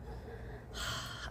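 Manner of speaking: long weary whispered sigh; heavy sigh. Real, not acted. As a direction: let out a deflated breath. Admitting she was bad at job.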